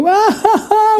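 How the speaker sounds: high mock Bond villain cackle; a hammed-up evil laugh in a Russian accent; sinister villain laugh. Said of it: "We started laughing".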